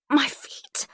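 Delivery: high, pained gasp; gasped cry of pain. A narrator's reading. Prisoner freed from straps, gasping in pain. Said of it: "I gasp".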